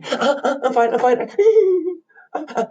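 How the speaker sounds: very high, whimpering nervous character; hammed-up terror with high-pitched whimpers; scared. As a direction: squeak and whimper through the introduction. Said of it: "looks a little scared".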